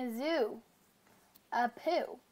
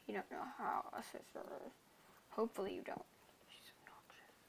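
quick conspiratorial whisper; 2 semitones lower, 17 dB quieter, faster than usual. Real, not acted. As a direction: whisper it to the audience. Whispered aside about sister.

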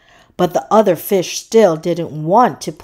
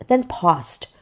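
usual speaking voice

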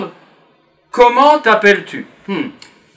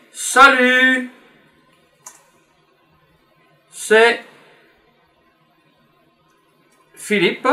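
broken up with long pauses, word by word; slow dictation; 6 semitones higher, much slower than usual. Shown as a demonstration. Teacher modelling a podcast greeting, slow and spaced. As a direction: dictate it slowly for the class to write. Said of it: "on pourrait dire peut-être".

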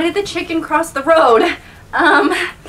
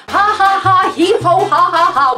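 mock laughter; 5 semitones higher than usual. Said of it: "I am gonna be cracking up".

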